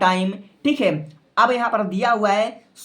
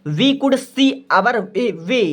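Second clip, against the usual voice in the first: slow dictation; 2 semitones higher, much slower than usual. Shown as a demonstration. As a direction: dictate slowly and clearly. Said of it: "तो यहाँ पर आप लोग क्या लिखोगे?". (धीरे-धीरे साफ़ लिखवाना)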